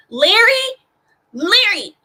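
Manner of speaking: loud call that cracks high; voice cracking. Real, not acted. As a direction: yell the name until the voice breaks. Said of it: "Did my voice just crack again?".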